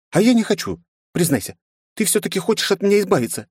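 put-on high young girl voice; girlish voice. A narrator's reading. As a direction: pitch up as a pouty teen. Leni's voice in narration.

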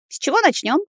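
bright, lifted question; eager question. Acted. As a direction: ask keenly how to start. Heroine asks cheerfully.

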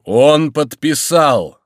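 high, loud, very drawn-out cry; exclaiming shout. A narrator's reading. Monk grabbing the signed paper, shouting the news. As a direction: proclaim it slowly at full voice. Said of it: "вскричал Горанфло".

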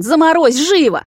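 high, loud, drawn-out command; barked order. A narrator's reading. Vampire orders the girl to freeze the puddle, high loud bark. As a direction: bellow an urgent order. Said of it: "рявкнул он".

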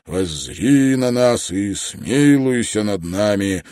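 loud, drawn-out phrases, reverent and earnest; heartfelt prayer. A narrator's reading. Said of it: "Его голос звучал проникновенно".

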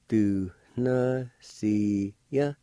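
slow, separated syllables; measured teaching pronunciation. Shown as a demonstration. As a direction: sound it out with short pauses. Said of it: "it's pronounced like this".

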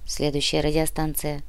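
ordinary delivery

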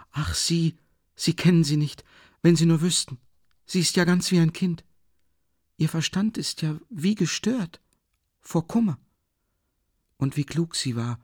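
despairing, agitated. Acted. Sonja desperately defends Katharina Iwanowna to Raskolnikov. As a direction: plead her stepmother's case in anguish. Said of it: "sprach wie in Verzweiflung, erregt und leidend".

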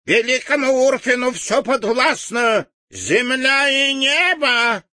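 высокий громкий крикливый возглас; выкрикнуть высоко и пронзительно; крикливо. Acted. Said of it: "крикливо объявил". (крикливое объявление высоким голосом)

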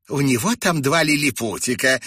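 high, loud amused jeer; smirking. A narrator's reading. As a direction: tell it with a scoff. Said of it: "усмехнулась женщина".

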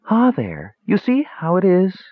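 hint of a dismal maiden, rising high; lightly voiced young maiden. A narrator's reading. Mage turned maiden lamenting his curse, lightly voiced. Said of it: "in the form of a lovely young maiden".

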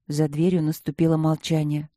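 ordinary delivery

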